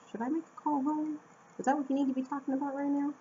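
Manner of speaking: teacher scolding. Retold or imitated. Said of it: "get the teacher face".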